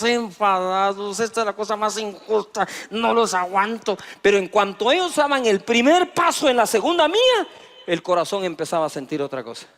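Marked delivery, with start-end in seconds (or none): 1.2-4.0 angry complaint. Retold or imitated. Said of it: "Se ponían enojados".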